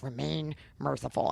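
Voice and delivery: low, slow, soft command; quiet solemn command. Retold or imitated. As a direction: hush it gravely to the dog. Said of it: "I will say, like".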